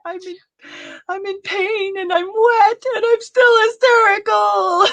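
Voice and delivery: hammed-up tearful shriek; Leo Bloom. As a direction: wail it in comic hysterics. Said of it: "who is hysterical because".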